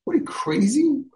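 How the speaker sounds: a very high, squeaky what are you crazy; incredulous rebuke. Retold or imitated. Rabbi rebuking a father over politics, high incredulous. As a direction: throw it up high in disbelief.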